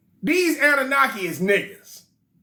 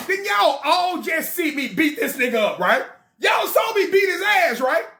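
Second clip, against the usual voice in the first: indignant king; 6 semitones higher than usual. Acted. Anu on the voicemail.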